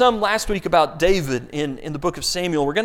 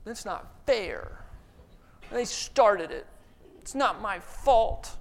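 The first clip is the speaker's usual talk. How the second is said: grown-up whining; 3 semitones higher, 4 dB quieter, slower than usual. Retold or imitated. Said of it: "when you hear it in the voice of a grown-up".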